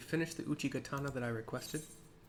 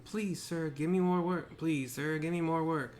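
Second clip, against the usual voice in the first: put-on pleading girl; 5 semitones higher, 7 dB louder, slower than usual.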